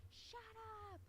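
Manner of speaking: high, sharp mock "shut up"; retold scream. Girlfriend turning on her boyfriend, high mock shout. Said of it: "she screams".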